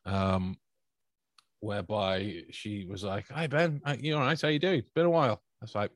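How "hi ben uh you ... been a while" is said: higher-pitched, chatty imitation of her; imitated woman's greeting. Woman greeting Ben after a while, imitated in a higher voice. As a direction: put on her higher voice for small talk.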